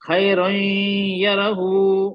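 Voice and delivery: "खैरुन" flowing into "यरहु" on a long nasal hum; nasal ghunna. Shown as a demonstration. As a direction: model recitation, holding the end of "खैरुन" in the nose. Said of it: "नाक से आवाज निकालना है".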